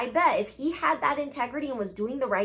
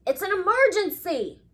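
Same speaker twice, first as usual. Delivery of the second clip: higher, louder alarmed call; urgent cry; 8 semitones higher, 4 dB louder than usual. Acted. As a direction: cry out for help. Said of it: "cried Ozzy".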